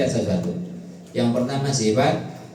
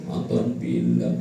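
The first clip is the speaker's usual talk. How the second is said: low, slow weepy reply; tearful mother; 13 semitones lower, 3 dB quieter, much slower than usual. Retold or imitated. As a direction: play the sniffling mom. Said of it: "Ibu-ibu nonton film India". (jawaban ibu yang sedih, pelan dan rendah)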